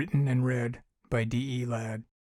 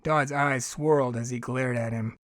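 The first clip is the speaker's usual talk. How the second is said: usual speaking voice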